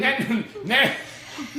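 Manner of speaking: slow, drawn-out alien greeting; Mork impression. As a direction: stretch it out like Mork. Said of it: "That was Mork from Ork".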